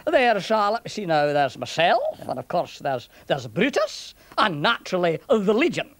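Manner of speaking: musing Scots soldier; Scottish centurion, reckoning up. Acted.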